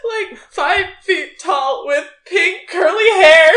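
high, loud, slow wailing through laughter; fake sobbing shout. Mother fakes panic describing her lost child. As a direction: howl it like a frantic mother.

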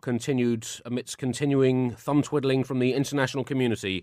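grave newsreader voice; solemn headline delivery; news read. Acted. Mock serious news read.

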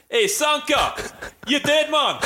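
put-on high-pitched Cool Runnings Jamaican accent. Quoting the Cool Runnings scene, high Jamaican accent. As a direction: call it out in a mock Jamaican lilt. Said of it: "but when he goes".

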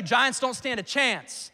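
usual speaking voice

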